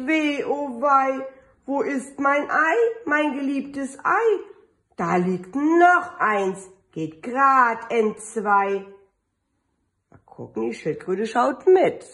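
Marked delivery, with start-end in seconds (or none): 0.1-4.4 a hint of mock sorrow, pitched up; lightly voiced hen lamenting; sad. Mother hen mourns her beloved lost egg, mildly sad and higher. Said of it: "Frau Huhn sagt traurig". 5.0-8.8 storybook reading. Acted. Reads picture book to children.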